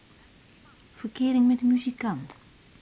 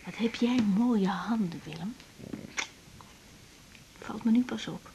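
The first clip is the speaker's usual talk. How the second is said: soft tender whisper; 5 dB quieter than usual. Acted. Woman admiring lover's hands, tender whisper. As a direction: murmur it tenderly.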